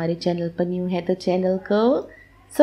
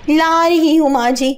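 higher, talking back with sass; cheeky retort; 6 semitones higher than usual. Acted. Daughter-in-law talks back to her mother-in-law about the tea, higher and cheeky. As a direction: fire it back, cheeky and high.

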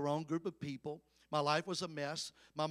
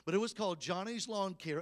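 ordinary talk

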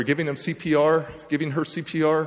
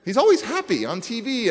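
mocking complaint; 7 semitones higher, 4 dB louder than usual. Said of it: "And I'm like".

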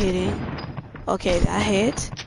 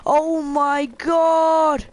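panicking gamer; 8 semitones higher than usual. Real, not acted. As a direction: yell in a mock panic to run. Tsunami in game, panic.

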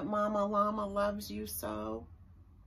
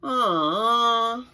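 hammed-up whiny louder moan; mock moan; 3 semitones higher, 9 dB louder than usual. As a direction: play a sulky little moan. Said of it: "starts to moan".

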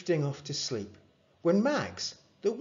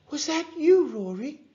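higher, lightly voiced surprised mum; mum asking; 5 dB louder than usual. A narrator's reading. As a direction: mum checking in, a touch amazed. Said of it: "she asked".

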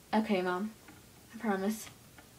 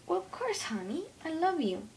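sweet mom voice; 7 semitones higher than usual. Pretend. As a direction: reassure her like a doting mom. Kid plays loving mom.

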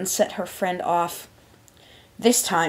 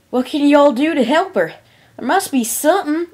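loud, higher, urgent plea; raised, insistent country-pony voice; desperate Applejack; 9 semitones higher, 8 dB louder than usual. A narrator's reading. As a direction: demand help for a sick friend. Applejack pleads for her sick friend.

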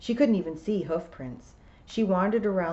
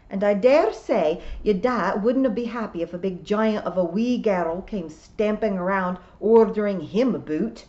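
louder, emphatic Scottish voice; indignant old Scots piper; 3 semitones higher, 7 dB louder than usual. A narrator's reading. Ninety-six-year-old William rebuking a girl, indignant Scots. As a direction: bristle as an old Scot.

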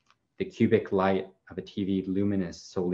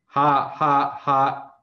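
higher, louder mock laugh of ghosts; ghostly laughter; 6 semitones higher, 7 dB louder, much slower than usual. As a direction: cackle it out like a chorus of ghosts. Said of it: "say the ghosts".

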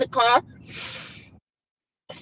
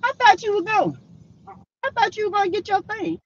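mimicking Wanda; 10 semitones higher, 5 dB quieter, faster than usual.

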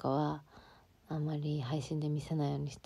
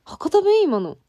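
shocked high yelp, much louder; surprised; 13 semitones higher, 13 dB louder, slower than usual. Real, not acted. Caught out by her own Hakata dialect, shrieking surprise. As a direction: blurts out in loud disbelief. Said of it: "なんで博多弁なん？".

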